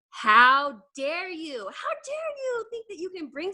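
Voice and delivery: slow, loud how dare you; slow indignant scold; mock outrage. Real, not acted. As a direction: draw out each word in outrage. Scolding listeners who skip development.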